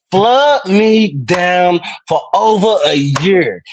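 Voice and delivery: drawn out, slow and hammed-up; slow mock plea. Teasing Darlene on stream, slow hammed-up plea. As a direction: stretch each word like a mock plea.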